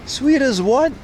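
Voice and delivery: high, disbelieving question on sweet as what; incredulous. Real, not acted. Streamer teasing Australians about their slang, incredulous high rise. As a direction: demand the missing comparison, teasing.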